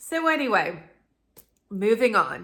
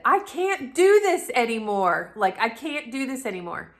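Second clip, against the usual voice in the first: louder, higher mock scream that fades by the repeat; retold scream; 5 semitones higher, 7 dB louder than usual. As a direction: relive the bathroom breakdown as a half-yell. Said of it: "I just screamed in the bathroom".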